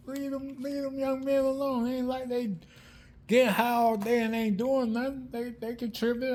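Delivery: high-pitched imitated protest; mock protest. Homeboy defending the young men staying there, mock protest.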